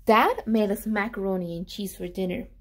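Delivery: ordinary delivery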